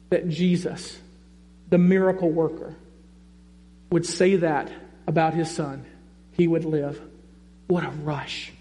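normal talking voice

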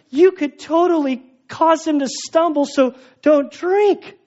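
high-pitched, insistent warning that slows as it goes; urgent admonishing. Acted. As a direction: plead like a worried, scolding believer. Acted plea from weaker believer.

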